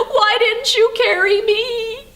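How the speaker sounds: high, drawn-out mock wail; hammed-up tearful plea to Daddy; crying child, imitated. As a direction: whine it like a jealous little girl. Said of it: "the older sister started to cry".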